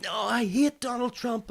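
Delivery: high-pitched imitated outrage; mock screaming. Public reacting to headline, mock hysterical shouting. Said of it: "screaming and shouting".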